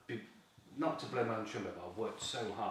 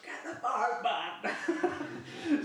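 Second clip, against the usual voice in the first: much higher, loud call for the boat; retold shout; 8 dB louder, much slower than usual. Shouting to Gav to bring the boat, high and loud. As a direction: yell across the lake, laughing.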